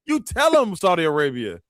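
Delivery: high-pitched hyped-up egging on; mock excited cheering. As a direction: cheer the crowd on in a squealing high voice. Acting himself cheering on Saudi crowd.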